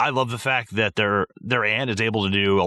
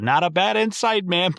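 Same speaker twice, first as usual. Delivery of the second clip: higher, lightly imitated exterminator line; girlfriend quoting line; 9 semitones higher than usual. Girlfriend mouthing her favourite line, lightly voiced and higher. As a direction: echo the line as a fan would. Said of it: "literally mouthing it with the word".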